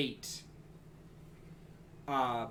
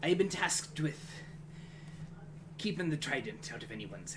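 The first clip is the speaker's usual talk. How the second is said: lightly voiced weary guardian, long pauses; a touch of battle-drained solemnity; exhausted guardian; 5 dB quieter than usual. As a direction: speak as if worn out, halting between phrases. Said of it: "just exhaustion".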